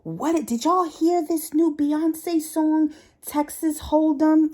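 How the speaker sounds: high, loud, slow and eager; jumping up to share the news; excited coworker. Retold or imitated. Coworker jumping up to ask the office about the song, high and drawn out. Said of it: "she jumps up and she's like".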